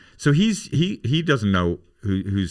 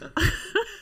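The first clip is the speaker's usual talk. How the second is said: high squealing laugh; 4 dB quieter than usual. Real, not acted.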